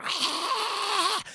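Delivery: high breathy creature shriek; alien screech. Retold or imitated. Alien opening its inner mouth, high screech. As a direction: imitate a monster's drawn-out screech.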